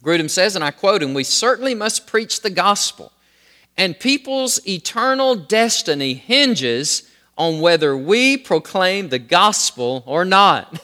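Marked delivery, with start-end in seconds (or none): 1.2-10.8 high, slow, emphatic proclamation; passionate. Acted. Preacher quoting an author on the need to preach, passionate. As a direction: preach the quote with urgent conviction. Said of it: "he says this very passionately".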